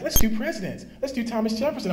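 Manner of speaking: a hint of a keen child asking; eager daughter. Father voices daughter choosing topic.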